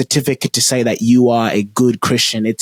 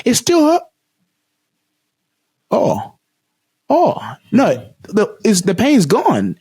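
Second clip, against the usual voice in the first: put-on high-pitched boy halting in disbelief; imitated dawning surprise; 10 semitones higher, slower than usual. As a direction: act out the boy's stunned realisation, pausing mid-thought. Boy discovers his pain is gone.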